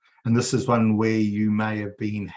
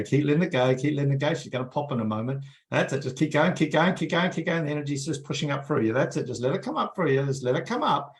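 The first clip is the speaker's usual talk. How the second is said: higher, pushing keep going; urgent encouragement; 6 semitones higher, faster than usual. Acted. Healer urging the group to push energy up, higher and eager. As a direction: urge them on like a coach at the finish.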